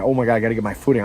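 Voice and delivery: fast, frantic muttering; scrambling for footing, words tumbling out fast; panicked rush. Retold or imitated. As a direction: rattle it off in a hurry. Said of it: "I'm like".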